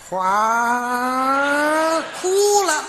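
hugely drawn-out whoosh for weeping; comic sound effect of bursting into tears; mock gushing cry. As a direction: stretch the cry into a long rising gush. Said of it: "有这么哭的吗？".

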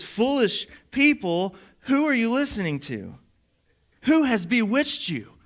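high, drawn-out preacher's reproach; exasperated rebuke. Acted. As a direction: rebuke them slowly, word by word. Preacher voices Paul's rebuke.

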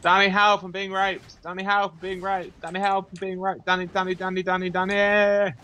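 high, insistent panicked pleading; mock-distressed high chant for rescue; mock cry for help. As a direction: call out in hammed-up panic, stretching the last name. Mock cries for help in game.

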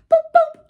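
loud, high boop-boop; car horn imitation. Toad imagining his next motor car, gleeful horn toots.

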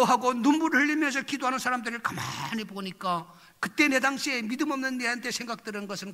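ordinary talk

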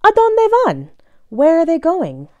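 lively sing-song picture-book reading; reading to children. Acted. Reading a bilingual dog book to young listeners, bouncy story voice. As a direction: read it like a story time for little ones.

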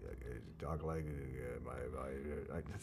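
very low, soft creak; vocal fry. Shown as a demonstration. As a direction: grind the voice into fry. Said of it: "that hurts".

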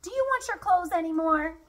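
high-pitched calling question. A narrator's reading. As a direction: call it across to them, pitched high. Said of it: "Sam called".

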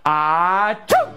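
long drawn-out "ahhh" before the "choo"; mock sneeze. Kids' entertainer leads children in sneezing, big fake sneeze. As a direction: wind up slowly into a fake sneeze. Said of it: "Can you sneeze? Say, Achoo".

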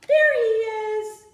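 high, loud delighted discovery; surprised gasp. Pretend. Teacher spotting Bruce for the kids, surprised. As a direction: gasp it out like you found a treasure.

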